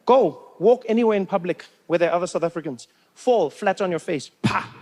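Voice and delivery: ordinary delivery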